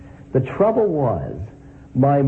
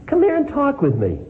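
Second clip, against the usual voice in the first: put-on much higher, easygoing old man's call; friendly old man beckoning; 13 semitones higher than usual. A narrator's reading. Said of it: "in the easy vernacular".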